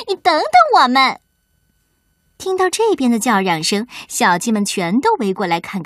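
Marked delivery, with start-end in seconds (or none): 0.1-1.2 high, fast, loud calling out; shouting after him. Acted. Carmen shouts after the fleeing sheep, fast high yell. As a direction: yell to a friend running away. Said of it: "卡门在后面大喊".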